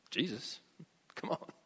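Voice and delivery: soft, low, offhand aside; imagined nonchalant reply. Retold or imitated. Preacher imagining Jesus' reply to the father, soft and offhand. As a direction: toss it off quietly, casually reassuring. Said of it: "Maybe he didn't say it like that".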